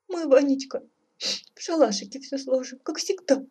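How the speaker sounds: high, sniffly motherly voice; tearful sniffling. A narrator's reading. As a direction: hold back tears, speaking high. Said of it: "хлюпнула носом царица". (всхлипывая, высоким голосом)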